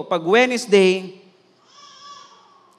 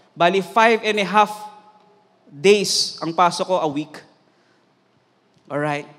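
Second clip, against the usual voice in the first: ordinary talk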